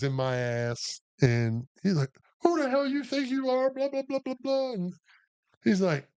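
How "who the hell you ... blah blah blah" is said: high, hammed-up sergeant tirade; mock chewing-out. Sergeant digging into a private, mock outraged. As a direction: rattle off a high-pitched mock telling-off.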